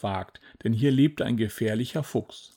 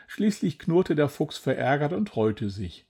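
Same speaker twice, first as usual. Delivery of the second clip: plain reading voice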